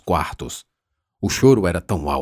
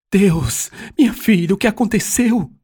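high-pitched, louder cry of alarm; alarmed exclamation; 12 semitones higher, 4 dB louder than usual. A narrator's reading. Mother finding her bloodied daughter at the door, alarmed exclamation. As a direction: exclaim in shock, voice leaping up. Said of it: "Maria Duarte exclamou do corredor".

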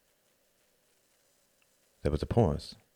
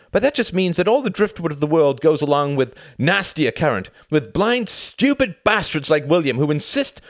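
raised, loud, mounting tirade; angry rant; 9 semitones higher, 5 dB louder than usual. Acted. Tom sick and tired of his friend's misplaced allegiance, furious. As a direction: let the frustration pour out, building.

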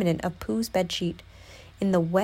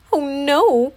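higher, louder cry of alarm; crying out, dismayed; 5 semitones higher, 6 dB louder than usual. A narrator's reading. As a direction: exclaim it in acted dismay. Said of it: "Piglet cried".

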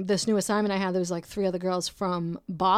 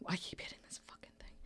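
sighing, fast, much softer whisper; annoyed whispered aside; muttered aside; 15 dB quieter, faster than usual. Real, not acted. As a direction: grumble it under your breath.

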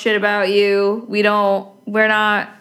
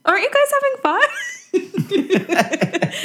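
playful whine; 12 semitones higher than usual. Pretend. Mock whiny protest at wrapping up.

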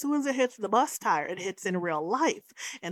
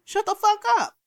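high, loud retold snap of "shut the fuck up"; mock angry snap; 12 semitones higher, 6 dB louder than usual. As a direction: bark it at a whiny teammate. Teammate tells Scotty off.

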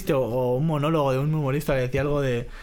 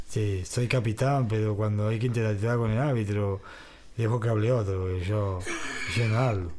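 shy Messi; 4 semitones lower, 3 dB quieter than usual. Retold or imitated. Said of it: "¿Cómo habla Messi?".

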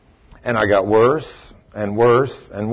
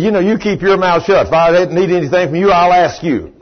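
higher, louder telling-off; angry rebuke; 6 semitones higher, 4 dB louder than usual. Retold or imitated. Boss telling a meddling visitor to stay out of it, angrily. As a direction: re-enact shutting down someone who butted in.

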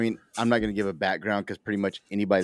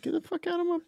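very high, thin mock yell; squeaky mock coach; 5 dB quieter, faster than usual. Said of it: "he said".

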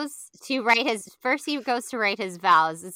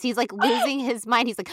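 ordinary talk

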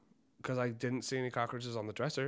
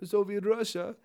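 mock Russian accent; 7 semitones higher than usual. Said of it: "Is this the Russian guy?".

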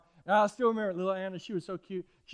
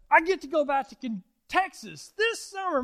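put-on higher, thrilled little girl, Texas slowed; excited girl; 8 semitones higher, 7 dB louder than usual. Pastor quoting young Anna's joy about her trip, excited. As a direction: bubble over with excitement. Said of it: "And she said".

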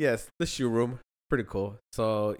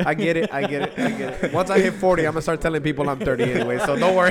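laughing tease; 4 semitones higher, 11 dB louder than usual. Real, not acted. Teasing friend about age.

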